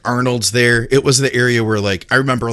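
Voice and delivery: usual speaking voice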